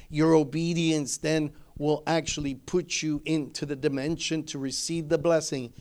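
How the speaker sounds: ordinary talk, nothing marked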